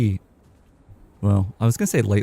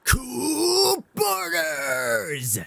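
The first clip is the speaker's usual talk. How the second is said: very high, long drawn-out announcer cry; slow, high-pitched imitation announcer yell; Cool Boarders announcer; 4 dB quieter, much slower than usual. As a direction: stretch each word out like a game title shout. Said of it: "starts out with the dude going".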